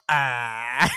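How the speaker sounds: a mock football kid's grunt, laughing; laughing Valley-kid grunt; imitated grunt. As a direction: grunt it like a shy interviewed kid. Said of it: "and he goes".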